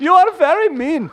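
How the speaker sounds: high, loud put-on protest; offended visitor. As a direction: object as an offended foreign visitor. Visitor scolds the New Yorker.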